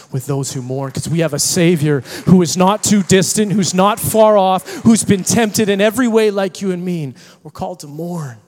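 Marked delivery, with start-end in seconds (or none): 0.9-7.1 loud emphatic preaching. Real, not acted.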